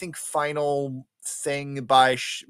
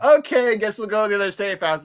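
loud, high opening, then quicker; Hongo; 5 semitones higher, 8 dB louder, faster than usual. Retold or imitated. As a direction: blurt a hammed-up reluctant agreement. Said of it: "And Hongo's like".

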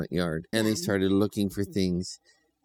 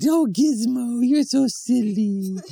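much higher and slow, fond; sweet children's voice; 8 dB louder, slower than usual. A narrator's reading. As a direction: coo at the puppy like a small child. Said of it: "And they said".

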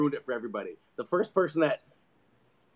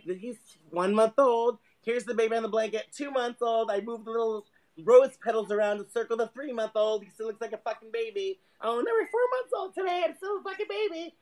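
mock new mom; 7 semitones higher than usual. New mom posting monthly baby photos, syrupy mock coo.

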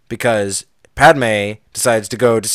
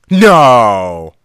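loud, drawn-out no; emphatic denial; 7 dB louder than usual. Real, not acted. Host defends George Lucas from cocaine joke, emphatic denial. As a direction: stretch it out loudly in protest.

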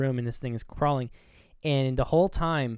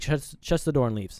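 normal talking voice

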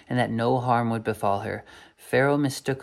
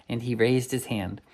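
usual speaking voice

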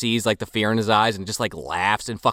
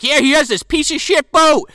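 retold taunt; 14 semitones higher, 5 dB louder than usual. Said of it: "He's like".

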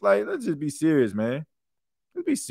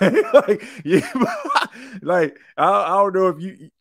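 laughing; 6 semitones higher, 3 dB louder than usual. Real, not acted. Laughing at the troll's insults.

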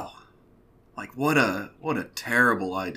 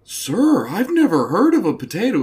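higher, louder mock-polite voice; a hammed-up innocent plea; mock earnest boyfriend; 8 semitones higher, 8 dB louder than usual. Boyfriend to the father, mock-earnest high and loud.

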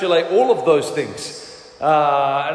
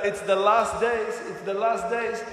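imitating an odd hushed voice, softer; mock voice of Renata; 3 semitones higher, 5 dB quieter than usual. Pastor mimics mother-in-law warning of last days, strange soft voice. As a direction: repeat it in her strange put-on voice, quietly. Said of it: "I don't know why she sounds like that".

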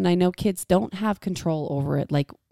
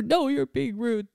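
slow, higher mock child voice; imitating sulky kid; 6 semitones higher, slower than usual. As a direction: drag it out like a sulking kid. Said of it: "If they're like".

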